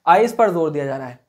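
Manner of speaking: ordinary talk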